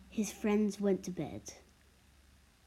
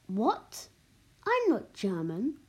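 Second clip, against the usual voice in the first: confident, mildly indignant denial; firm protest with lively pitch; 3 semitones higher, 4 dB louder, slower than usual. A narrator's reading. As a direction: push back with some confidence. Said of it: "said in a confident tone".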